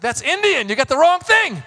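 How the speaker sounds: outraged at the costume mix-up; indignant protest. Retold or imitated. Actor protesting to wardrobe, high indignant objection.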